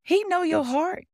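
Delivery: preaching emphasis. Real, not acted. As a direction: proclaim it.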